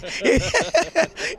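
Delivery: high chuckling laugh; amused burst of laughter. Real, not acted. Host laughing after a joke about lost keys, laughing.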